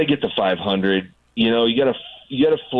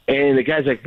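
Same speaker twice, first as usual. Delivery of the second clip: usual speaking voice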